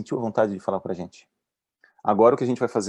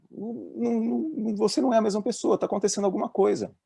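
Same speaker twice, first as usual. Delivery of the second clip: very high, pleading tone; concerned plea; 12 semitones higher than usual. Retold or imitated. Manager urging the struggling doctor to open up, high and pleading. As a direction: beg her gently to say what is wrong.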